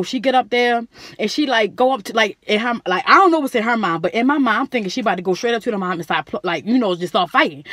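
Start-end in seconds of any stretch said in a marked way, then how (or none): none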